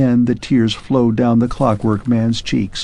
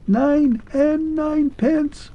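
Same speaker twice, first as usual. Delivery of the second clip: much higher, slow, faint and clear; a tiny voice from far away; very thin and distinct; slower than usual. Acted. Clockwork man's last words reach Arthur, very thin. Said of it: "very thin and distinct".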